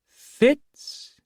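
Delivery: high, piping singsong; piping fool voice. A narrator's reading. The fool intoning at the boy, piping high. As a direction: pipe the name up like a strange jester. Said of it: "he intoned in a piping voice".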